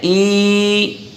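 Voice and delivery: ई held long, dragged out; wrongly stretched vowel. Shown as a demonstration. Teacher showing children the mistake, ई stretched. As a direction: draw ई out far too long. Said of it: "बगैर खींचे".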